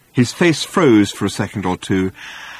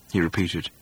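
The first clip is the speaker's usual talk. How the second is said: plain reading voice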